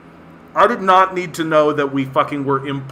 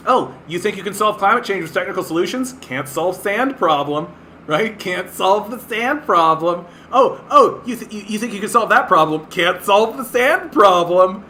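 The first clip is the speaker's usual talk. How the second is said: fast, higher taunting voice; taunting mockery; 4 semitones higher than usual. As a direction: jeer at them like a schoolyard taunt. Mocking climate tech believers.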